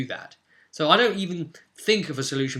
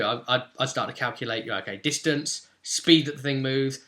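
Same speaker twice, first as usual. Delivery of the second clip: ordinary talk, nothing marked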